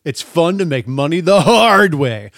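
upbeat announcer ad read; mock ad read. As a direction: sell it like a radio spot.

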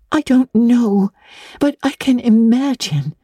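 put-on high, eager little girl's voice. A narrator's reading. Anne answers Miss Barry eagerly, in a high girl's voice. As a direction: jump in with bright eagerness. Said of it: "said Anne eagerly".

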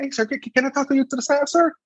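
high put-on voice of a staff sergeant pulling him aside; a hammed-up polite sergeant; mock polite sergeant.

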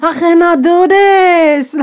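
high, loud, drawn-out wail; theatrical slow lament; mock overwhelmed. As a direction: stretch it into a hammed-up cry of exasperation. Acting out being overwhelmed by messages.

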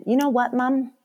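sassy daughter. Retold or imitated. Mother mimicking her sassy daughter, lightly voiced. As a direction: toss it off like a cheeky kid. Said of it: "My daughter sasses me".

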